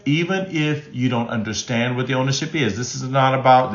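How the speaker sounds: ordinary delivery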